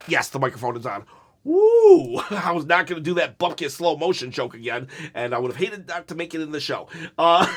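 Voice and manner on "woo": high, loud "woo"; giddy, high-pitched holler; excited whoop. Real, not acted. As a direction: let out a sudden whoop. Hyped outburst after mic check.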